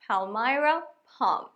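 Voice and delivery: "palmyra palm" said very slowly, "palm" as pahm with no l; silent l in palm. Shown as a demonstration. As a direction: stretch out "palmyra palm", no l sound in "palm". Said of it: "palm-এর এলটা silent".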